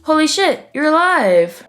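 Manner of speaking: high-pitched astonished exclamation; mock amazement. Cryptic realising Fish is alive, mock amazed.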